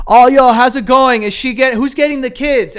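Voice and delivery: loud, high, prying questions; nosy paparazzi. Pretend. Paparazzo grilling a divorcing celebrity, loud and prying. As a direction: shout the gossip question at him.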